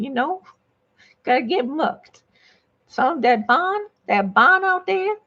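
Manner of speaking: hammed-up Southern lady accent; Southern gentlewoman. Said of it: "Let's turn the Southern gentle".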